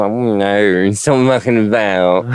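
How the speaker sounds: lightly voiced camp comic; a hint of Kenneth Williams; Kenneth Williams impression. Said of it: "people like Kenneth Williams".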